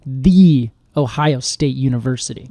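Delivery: THE stressed first, firm and full; The Ohio State University with THE punched. Shown as a demonstration. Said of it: "[name], again".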